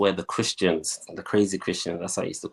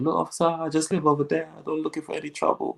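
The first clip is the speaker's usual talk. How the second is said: innocent plea; 9 semitones higher, faster than usual. Retold or imitated. Talking innocently to police officer.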